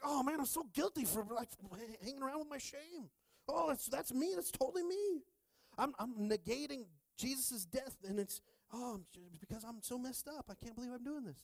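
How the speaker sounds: high mock wail of self-blame; guilty listener. Listener confessing to carrying shame, high mock anguish. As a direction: ham up the guilt in a very high put-on voice.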